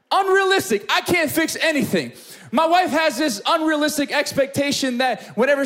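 high-pitched incredulous protest; exasperated comedic outburst; comic exasperation. Acted. Comic outburst about wife's expectations.

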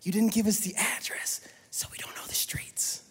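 hushed whispered aside. Acted. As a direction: whisper it as a secret complaint. Mock aside as Thomas.

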